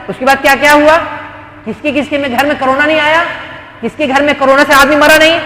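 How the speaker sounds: normal talking voice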